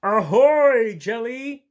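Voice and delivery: high, loud cheerful sea creature call; Narwhal's greeting. Acted. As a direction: hail your friend from across the water. Narwhal character greeting friend.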